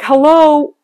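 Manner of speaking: a playful retold scolding, up and loud; mock husband's rebuke. Husband teasing her worry, mock loud rebuke. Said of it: "My husband said to me".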